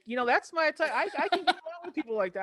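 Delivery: chuckling as she admits she likes drama; laughing. Real, not acted. Host laughing while telling the guest she likes dramatic people.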